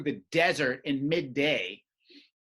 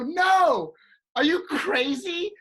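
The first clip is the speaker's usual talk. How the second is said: high and loud, disbelieving; incredulous yell; 12 semitones higher, 7 dB louder, slower than usual. Retold or imitated. Friend scolding the tea drinker, high disbelieving shout. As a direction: shout it like he's lost his mind. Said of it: "And I go".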